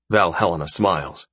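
ordinary delivery

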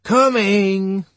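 high, loud hoarse woman calling back; hoarse female voice. A narrator's reading. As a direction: call back from another room as a hoarse woman. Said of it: "a rather hoarse female voice said".

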